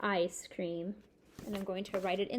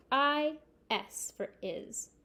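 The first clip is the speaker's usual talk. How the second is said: slow spelling; 4 semitones higher, slower than usual. Shown as a demonstration. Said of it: "spelling aloud slowly".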